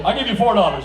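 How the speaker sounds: loud mock bargain; firm tourist offer; haggling. Haggling with vendor.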